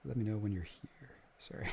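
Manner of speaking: quick hushed whisper off to the side; whispered aside. Real, not acted. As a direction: drop to a whisper for someone in the room. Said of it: "aside off mic, whispered".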